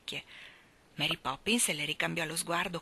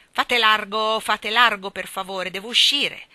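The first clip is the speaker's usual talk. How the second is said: loud, raised, commanding call; powerful voice; 4 semitones higher, 9 dB louder than usual. A narrator's reading. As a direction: boom out to clear a path. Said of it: "riprese la voce potente". (voce potente e imperiosa)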